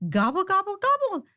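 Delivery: very high hollering turkeys; a flock gobbling; hollered gobble. A narrator's reading. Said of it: "the turkeys hollered".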